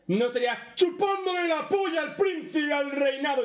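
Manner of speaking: grito indignado muy agudo y fuerte; indignant shout. Real, not acted. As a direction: sube de golpe la voz, indignado. Indignant rant about the character. (grito de rabia contra el personaje)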